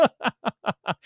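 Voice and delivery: high cackle in a long run; laughing. Real, not acted. Streamer ribbing cheapskates in chat, laughing. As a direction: burst into a rapid, high laugh. Laughs while teasing his audience.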